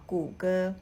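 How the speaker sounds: "กูเกอร์" said as Mandarin gu-ge; "กูเกอร์" in Chinese tones; Mandarin reading. Shown as a demonstration. Teacher giving the Chinese name for Google, Mandarin tones. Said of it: "คนจีนอ่านว่า".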